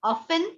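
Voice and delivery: Often as OFF-en, no t, rising; Often as OFF-en with a rise; silent t. Shown as a demonstration. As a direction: say Often without the t, tune rising. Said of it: "Americans often बोलते हैं".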